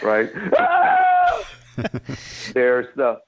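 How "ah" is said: high pleading scream for help; save me death scream. Shown as a demonstration. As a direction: scream like begging to be saved. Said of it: "save me death scream".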